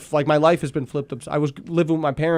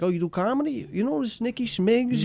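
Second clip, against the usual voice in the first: soft, higher-pitched Italian guy impression; random Italian man; 7 semitones higher, 7 dB quieter than usual. Random Italian man asking about comedy, imitated quietly. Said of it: "some random Italian man was like".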